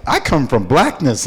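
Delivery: ordinary talk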